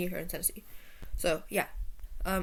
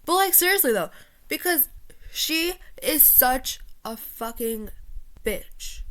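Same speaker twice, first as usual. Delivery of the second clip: exasperated venting; 5 semitones higher than usual. Real, not acted. Venting to viewers about the friend after a pause, exasperated. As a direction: burst out, then drag out the insult.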